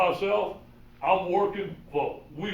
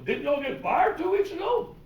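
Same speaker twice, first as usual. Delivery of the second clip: surprised question; 10 semitones higher than usual. Retold or imitated. Pete asking if fired.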